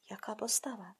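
softly whispered praise; whispered admiration. Acted. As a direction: breathe it like a secret marvel.

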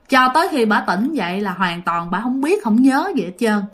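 plain reading voice

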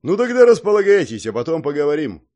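very loud, high start, then easing down; hint at a talk to come; promising, pointed. A narrator's reading. Old man tells guests to settle, pointedly promising. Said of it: "многообещающе проговорил Гордей".

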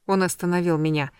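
ordinary delivery

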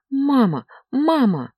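high, plaintive call; crying child. A narrator's reading. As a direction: call for mother like a tearful boy. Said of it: "плакал Илья". (жалобный плач ребёнка)

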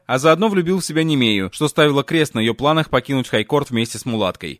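usual speaking voice

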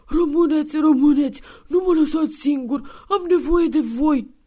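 put-on high, loud old-man sobbing plea; crying like a child. Santa, head in their laps, begs them to stay. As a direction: beg through tears like a kid. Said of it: "plângea ca un țânc".

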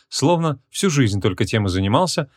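plain reading voice

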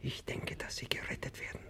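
hushed whisper, almost no voice. Acted. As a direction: breathe it so only he hears. Secret farewell words to baron.

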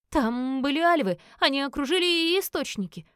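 put-on rushed, breathless frightened girl; stammering in fright. A narrator's reading. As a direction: gabble it out in panic. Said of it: "заикаясь, протараторила она".

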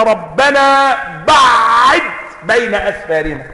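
"بعد" بتشديد العين وكسرها ببطء شديد; مُدّ "ربنا بعد" على الدعاء; قراءة ثالثة. Shown as a demonstration. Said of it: "وفي قراءة ثالثة". (رَبَّنا بَعِّدْ ببطء)

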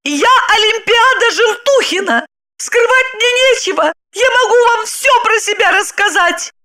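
high, heated self-introduction; blurted out. A narrator's reading. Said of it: "выпалила Липочка". (выпалить высоким голосом)

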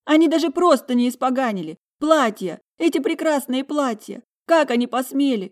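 high, loud, emotional lament; hurt-child outrage. A narrator's reading. Talia lamenting the ruined dresses, outraged and tearful. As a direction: wail indignantly like a wronged child. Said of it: "совсем как ребёнок, которого сильно обидели".

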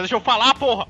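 loud. Real, not acted. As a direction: cut in and shout to be heard. Interrupted, demands to speak. (grito irritado)

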